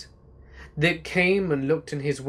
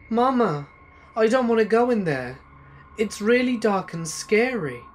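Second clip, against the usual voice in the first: put-on higher, pleading frightened little girl; scared child; 8 semitones higher, 4 dB louder than usual. A narrator's reading. Daughter runs up to her mother, scared and upset. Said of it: "ran up to me crying and was incredibly scared".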